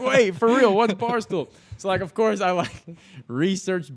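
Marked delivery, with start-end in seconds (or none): 0.0-1.5 high, louder disbelieving question; incredulous. Retold or imitated. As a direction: ask it in real disbelief, pitched up. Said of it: "I was like".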